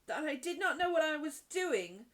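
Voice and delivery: high, loud, incredulous question; gasping disbelief. A narrator's reading. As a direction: echo it back in outrage. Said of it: "Miss Minchin fairly gasped".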